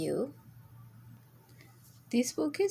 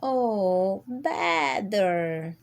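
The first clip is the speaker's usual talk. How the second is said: bleating sheep; 4 dB louder, much slower than usual. A narrator's reading. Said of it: "bleated Sheep".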